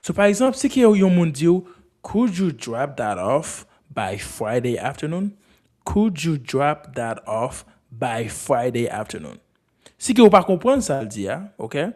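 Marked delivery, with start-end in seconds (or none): 2.0-9.4 slow, lower, carefully spaced example; slow model sentence. Shown as a demonstration. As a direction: say it slowly for learners.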